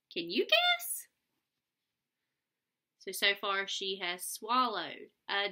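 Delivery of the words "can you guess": high, loud playful ask; high loud sing-song can you guess; excited question. Acted. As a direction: throw it up high and bright at the kids. Asks kids teasing question.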